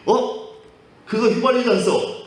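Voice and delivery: high-pitched jolt of surprise; startled protest. Acted. Patient catching out the doctor's trick, startled protest. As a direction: blurt it out high, caught off guard. Patient suddenly recognizes the taste.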